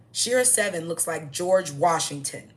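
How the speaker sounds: slow it down and hammer every word; emphatic repeat. Shown as a demonstration. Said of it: "Are y'all kidding me?".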